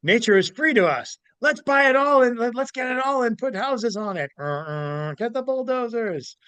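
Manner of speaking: mock the greedy rich. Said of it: "But then the rich say".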